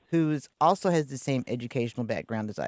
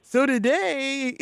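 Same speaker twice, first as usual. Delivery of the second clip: high, loud, slow casual greeting; a laid-back radio host saying hey; casual homegirl voice; 11 semitones higher, 6 dB louder, much slower than usual. Pretend. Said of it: "be like".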